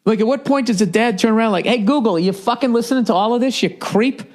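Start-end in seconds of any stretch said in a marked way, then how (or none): 1.7-4.2 high, accusing dad voice; suspicious dad. Retold or imitated. Dad turning on Google, high accusing voice. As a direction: call out the creepy gadget.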